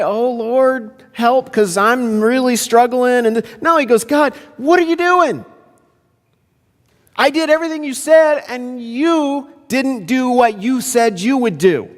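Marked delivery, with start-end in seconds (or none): 0.0-3.3 mock plea. Acting meek prayer Moses didn't say. 4.0-11.9 very high, incredulous acted reproach; outraged challenge. Acting Moses challenging God.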